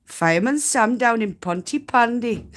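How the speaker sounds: quoting a kids' show in mock Welsh; Welsh accent. Spinner doing Fireman Sam for chat, playful Welsh accent. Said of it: "I like to do Welsh as well".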